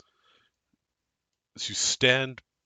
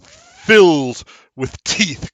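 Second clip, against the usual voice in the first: usual speaking voice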